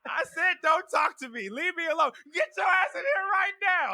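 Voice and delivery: high, loud hammed-up hollering; retold yelling match. Yelling back and forth through the house, mock furious. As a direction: yell it across the house in mock fury.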